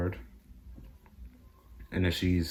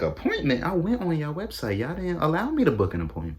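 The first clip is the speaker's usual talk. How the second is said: indignant; 9 semitones higher than usual. Retold or imitated. Indignant retort to the vaccine worker.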